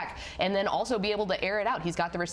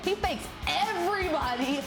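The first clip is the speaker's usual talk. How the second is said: highlights play-by-play; 2 semitones higher than usual. Real, not acted. Announcer narrating Whitesboro's highlights against Paradise, rising play-by-play. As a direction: build from scene setting to a soaring, stretched call.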